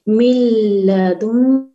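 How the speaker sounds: मिल लदुन very slow and loud, without nasal hum; merged without nasal. Shown as a demonstration. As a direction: stretch मिल लदुन slowly, no ghunna. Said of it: "यहाँ पे बिला गुन्ना". (बिला गुन्ना, बहुत धीमे)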